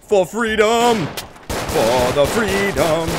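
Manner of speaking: drawn-out shouted battle cry, slow and loud. Pretend. Gamer charging the trench, slow shouted battle cry. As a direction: bellow it as you charge the enemy.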